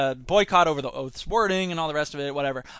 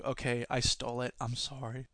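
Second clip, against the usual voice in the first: much softer, lower confession; sheepish whisper; 8 semitones lower, 14 dB quieter than usual. Real, not acted. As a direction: own up under your breath.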